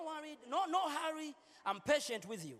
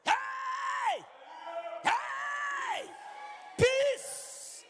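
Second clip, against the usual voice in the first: high, loud shouted greetings; shouting; 7 dB louder, much slower than usual. Shown as a demonstration. Pastor showing a harsh greeting, shouting. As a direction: bellow it across the hall.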